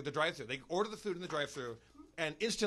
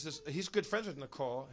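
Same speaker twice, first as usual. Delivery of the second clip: normal talking voice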